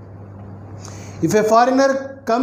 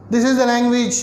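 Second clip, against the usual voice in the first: ordinary delivery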